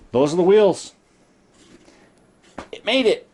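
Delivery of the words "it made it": much higher, triumphant; elated. Real, not acted. Telling viewers the wheel fits, triumphantly high. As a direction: celebrate that it finally fits.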